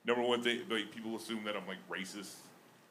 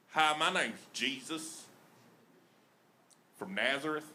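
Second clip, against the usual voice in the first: put-on Southern-accented Jesus; 3 semitones higher than usual. Comic retelling the Bible to a crowd, Southern accent. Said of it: "sounds better with a little Southern flair".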